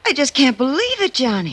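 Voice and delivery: gushing with joy; excited exclaim; overjoyed. Acted. Still overwhelmed by the gift.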